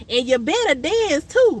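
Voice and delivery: high bossy tease; teasing elder. Retold or imitated. Friend insisting she dance at the funeral, high bossy tease. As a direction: playfully order her to dance.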